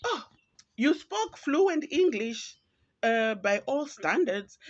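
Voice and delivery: condescending mock praise. Colleague in a meeting, high, slow patronizing praise. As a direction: act surprised they can speak English. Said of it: "they'll be like".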